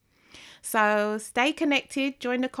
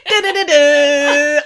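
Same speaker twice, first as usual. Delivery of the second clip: playful vocal riff; 5 semitones higher, 12 dB louder, slower than usual. Real, not acted. Playful hummed noise.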